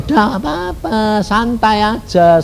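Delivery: slow, drawn-out soothing words; acted easygoing comfort, stretched out; calm reassurance. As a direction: ease someone's worry, unhurried. Husband calming his pregnant wife.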